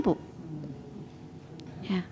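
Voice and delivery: normal talking voice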